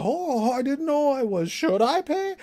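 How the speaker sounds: high, naive squeaky voice; mock falsetto stranger. Mocking the confused stranger's reply, high falsetto. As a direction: play the clueless stranger, up high.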